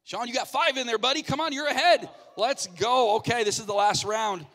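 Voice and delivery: loud, high-pitched game-host cheering; shouted rallying encouragement; excited hype. Real, not acted. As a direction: pump up the contestant. Host hyping the contestant's score.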